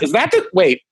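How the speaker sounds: put-on high, rising amazed child voice; excited kid. Son realizing dad copied the movie, amazed kid voice. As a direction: sound like a little boy figuring it out.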